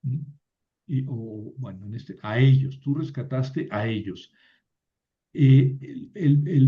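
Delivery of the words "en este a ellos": usual speaking voice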